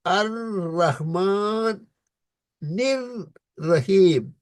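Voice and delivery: very slow tajweed recitation; slow Quranic recitation. Shown as a demonstration. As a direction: recite الرحمن الرحیم slowly with the doubled consonant held. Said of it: "جس طرح رب العالمین".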